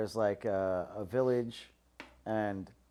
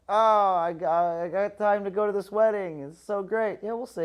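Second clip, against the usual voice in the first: mock gushing; 9 semitones higher, 8 dB louder than usual. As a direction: play giddy delight, then shrug. Gushing about the wedding.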